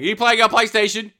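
high, loud accusing question; suspicious questioner. Acted. Someone catching a gamer hiding from play, loudly accusing. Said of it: "trying to hide from playing".

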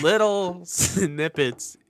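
drawn out with a laugh; slowly. Real, not acted. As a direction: stretch it out slowly.

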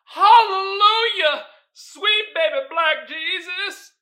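high, loud, drawn-out praise; hammed-up hallelujah holler; mock church shout. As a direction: wail it like a fired-up congregant. Mock church outburst at joke.

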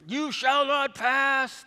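high, slow, booming wizard line; grand mock spell; mock wizard proclamation. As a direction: ham up a fantasy wizard blocking the way. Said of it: "get worked up and maybe throw".

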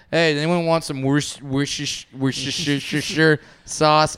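mangle Worcestershire into a stretched lisp; comically bad pronunciation. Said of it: "every time I just do it comically bad".

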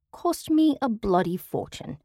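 put-on British accent, grand and haughty; an affected British lady complaining; affected British accent, bragging about the cost; British affectation. A narrator's reading. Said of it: "I noted the British affectation".

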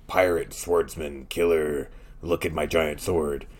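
lightly voiced pirate killer, slow at first; a hint of a boastful pirate, listing his traits; one-dimensional tough swordsman. Podcaster mocking a one-dimensional character, lightly voiced swordsman. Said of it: "if you're gonna be this one-dimensional".

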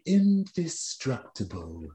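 soft slow indestructible, like Mr Burns; drawn out softly as Burns; Burns impression. Host voicing Mr Burns, soft and slow. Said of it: "Burns impressions".

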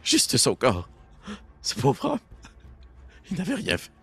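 tearful begging, voice rising high in places; pleading. Acted. Captive begging to leave with a friend's body, pleading. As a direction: plead brokenly, breaking upward on the pity. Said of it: "Et l'autre personne qui est avec vous".

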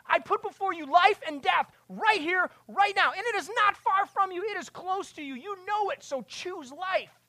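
high, loud, ringing scripture proclamation; preaching at full force. Acted. Preacher proclaims scripture to the church, loud and high. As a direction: cry it out to the congregation.

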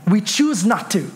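ordinary talk

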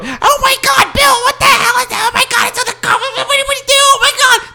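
very high, hysterical panicked shrieking; freaking-out mother. Retold or imitated. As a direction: screech in mock panic, words tumbling over each other. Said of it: "That's an accurate version of my mother".

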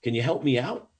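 high-pitched call to a friend; acted holler for help, not full volume; shouting up. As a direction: call up eagerly from the bottom of a hole. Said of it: "the guy shouts up".

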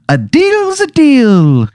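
high, slow Irish leprechaun; drawn-out leprechaun bargain. Acted. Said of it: "And he goes".

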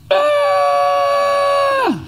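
high mock toddler scream. Imitating daughter screaming over dropped chapstick. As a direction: squeal like a startled toddler. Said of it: "She starts screaming".

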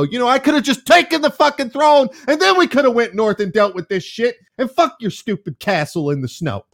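imagined queen finally snapping; exasperated outburst. Pretend. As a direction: blow up at everyone for wasting time. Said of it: "before you go".

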